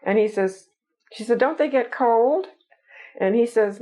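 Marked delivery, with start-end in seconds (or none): 1.4-2.5 high-pitched mock Southern accent; attempted Southern accent. Gullible Southern woman questioning Rudy about fish, attempted Southern accent. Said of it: "I can't do a Southern accent".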